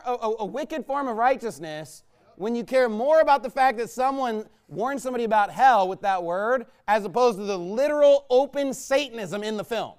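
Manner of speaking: usual speaking voice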